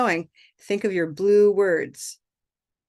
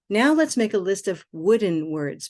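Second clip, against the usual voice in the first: ordinary delivery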